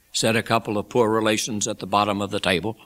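ordinary delivery

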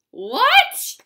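loud high squeal; mock shock. Reader shocked at the book's nonsense, loud high squeal. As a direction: shriek the what.

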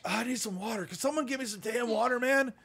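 hammed-up plea for water; desperate begging for water. Shaky Marine begs the marshal for water. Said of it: "and he goes".

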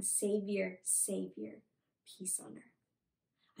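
low and soft, fading to a slow near-whisper; hushed recital. Retold or imitated. Teacher translates the crowd's words for children, hushed and fading. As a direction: let each phrase sink away reverently.

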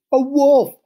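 high, loud startled shriek; alarmed cry. A narrator's reading. Three piglets meet a wolf at the door, alarmed cry. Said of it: "cried the three little pigs".

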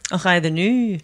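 hammed-up Scottish stock phrase; Scottish accent. Asked by the host for an accent, mock Scottish accent. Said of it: "how's your Scottish accent".

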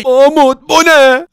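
alarmed, voice jumping up; alarmed villager. Acted. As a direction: react in sudden fright. Villagers startled by the sudden threat.

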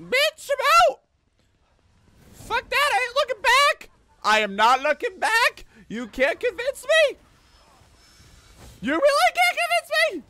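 high, loud squealed protest; panicked refusal. Real, not acted. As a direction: shout your refusal while fleeing. Flees monster, refuses to look back.

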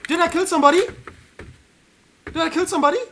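high, loud frantic yelling; frantic shouting. Real, not acted. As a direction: shout wildly mid-fight. Combat shouting.

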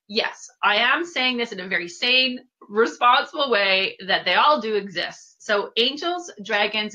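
ordinary talk, nothing marked

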